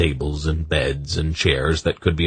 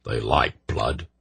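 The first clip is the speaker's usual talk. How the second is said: lower, quieter, slow grim line; bleak, slow and low; 6 semitones lower, 4 dB quieter, slower than usual. A narrator's reading. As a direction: let it drop, dark and slow. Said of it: "Dernick declared bleakly".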